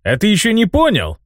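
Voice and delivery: high, gleeful taunt; mocking amusement. A narrator's reading. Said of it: "снова расхохотался старик".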